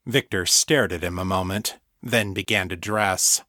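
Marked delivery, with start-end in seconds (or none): none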